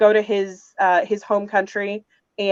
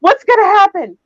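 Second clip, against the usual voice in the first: much higher and louder, thrilled; eager suspense; 11 semitones higher, 6 dB louder than usual. Retold or imitated. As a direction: shout it while banging the table. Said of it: "banging on the table".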